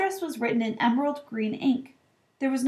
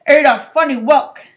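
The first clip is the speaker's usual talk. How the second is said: gruff uncle; 4 semitones higher, 12 dB louder than usual. A narrator's reading. Uncle reads postcard.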